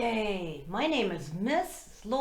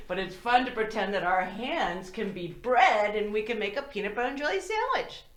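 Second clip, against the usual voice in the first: usual speaking voice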